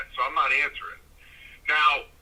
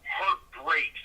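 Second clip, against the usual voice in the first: normal talking voice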